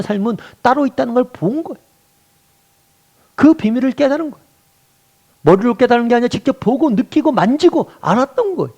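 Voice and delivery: high-pitched, fervent sermon climax; impassioned preaching. Real, not acted. Preacher telling the congregation they have seen eternal life, impassioned and high.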